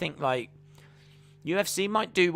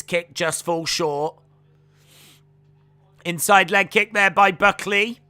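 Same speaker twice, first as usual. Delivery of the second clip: louder live fight call; play-by-play commentary; 3 semitones higher, 6 dB louder than usual. Acted. Streamer calling the UFC fight live for viewers.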